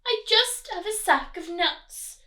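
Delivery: high squirrel voice; scared squirrel. Acted. Squirrel answering the rat, high and nervous. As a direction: pipe it up nervously.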